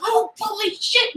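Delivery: high, put-on voice of shock; hammed-up shock; high surprised exclamation. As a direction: blurt out in mock alarm. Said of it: "he's like".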